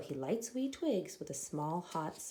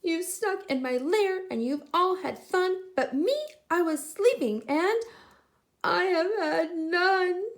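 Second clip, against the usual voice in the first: high, louder whiny bear; whining bear; 10 semitones higher, 7 dB louder than usual. Acted. Bear whines to friends who partied without him, high loud whine. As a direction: complain like a hurt big bear.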